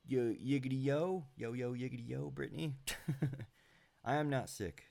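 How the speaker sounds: ordinary talk, nothing marked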